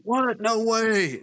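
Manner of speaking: very high squeak of disbelief; can't believe what they're hearing; shocked disbelief. Retold or imitated. Soldiers told it's Air Force housing, squeaky disbelief. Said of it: "Guys are like".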